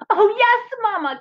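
put-on high, eager child mole voice; Little Mole crying out. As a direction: cry out like an excited little mole. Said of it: "cried Little Mole".

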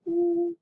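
"oo" drawn out on rounded lips; "oo" long and pure, no glide. Shown as a demonstration. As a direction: hold "oo" steady, mouth like a Cheerio. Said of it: "just a Cheerio mouth".

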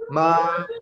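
MA held high and level; first tone, a long high level MA; high sustained tone. Shown as a demonstration. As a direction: sing MA on one high, even note. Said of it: "es alto y sostenido".

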